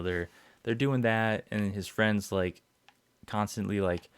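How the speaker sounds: normal talking voice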